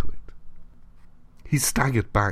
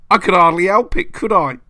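much higher, louder furious bellow; 9 semitones higher, 8 dB louder than usual. A narrator's reading. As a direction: roar it down the corridor. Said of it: "he bellowed".

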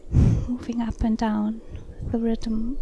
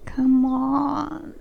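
pleading; 3 semitones higher, 5 dB louder than usual. Acted. Pleading with lover again.

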